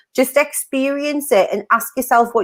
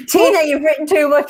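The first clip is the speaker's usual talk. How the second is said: usual speaking voice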